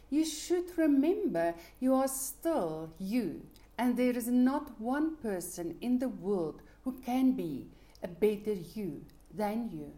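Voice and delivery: ordinary talk, nothing marked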